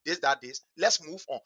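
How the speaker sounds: a hint of a household elder smoothing things over; father calling the house. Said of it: "as a father in the house".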